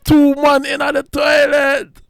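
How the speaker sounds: high, slow mocking yell; mocking shout. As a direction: shout it out to embarrass them. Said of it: "Darren Biggs was like".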